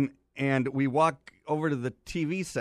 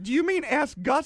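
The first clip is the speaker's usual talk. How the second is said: incredulous correction; 12 semitones higher, 3 dB louder than usual. Retold or imitated. Correcting mistaken name, incredulous.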